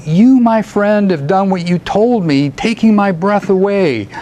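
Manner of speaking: high, theatrical praise in verse; declaimed poem dialogue. A narrator's reading. Poet praising his old friend in verse, declaimed. Reads character's speech in poem.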